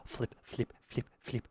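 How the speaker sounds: urgent breathless whisper; hushed panicked whisper. Real, not acted. Panicked gaming moment.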